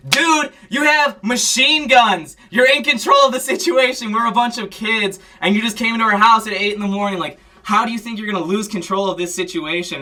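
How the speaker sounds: high, loud, incredulous outburst; indignant friend. Retold or imitated. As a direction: shout back at the cop, indignant. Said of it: "And she was like".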